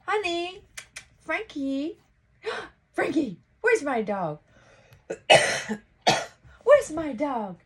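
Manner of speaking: high, frantic calls for her dog; panicked self in crisis. Retold or imitated. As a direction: mimic her own panicked past self calling Frankie. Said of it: "for them to think".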